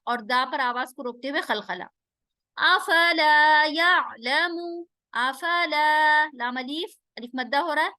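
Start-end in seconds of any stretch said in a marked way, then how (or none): 2.6-6.3 slow drawn-out tajweed chant; slow Quranic recitation. Shown as a demonstration. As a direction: recite each syllable slowly, melodically. Recites verse.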